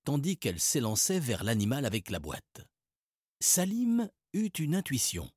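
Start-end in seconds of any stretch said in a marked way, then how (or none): none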